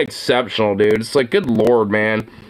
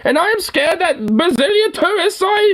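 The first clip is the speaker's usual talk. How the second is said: mock old hag; 12 semitones higher than usual. Mocking the woman in the video, high and sneering. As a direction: lampoon her in a raised mocking voice. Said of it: "It's like, fucking old hag".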